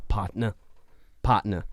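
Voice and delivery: "partner" said as "pot-nuh"; written-in accent. Acted. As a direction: drop both r sounds in "partner". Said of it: "he wrote the accent".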